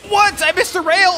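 loud high frustrated yell; frustrated shout. Real, not acted. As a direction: yell it out at the screen. Missed rail in game, shouting.

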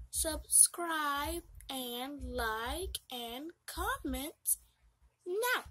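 drawn-out slow list; slow begging outro; slow plea. Real, not acted. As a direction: stretch each word out.

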